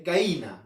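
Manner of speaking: "gallina" said as "gaína", ll dropped; Lima pronunciation. Shown as a demonstration. Teacher showing students how limeños say the word. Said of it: "Los limeños, por ejemplo, dicen".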